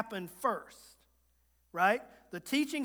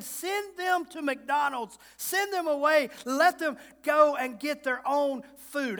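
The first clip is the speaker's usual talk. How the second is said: testy disciples; 5 semitones higher, 4 dB louder than usual. Retold or imitated. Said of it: "They were a little testy right there".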